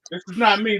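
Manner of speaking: normal talking voice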